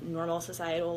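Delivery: normal talking voice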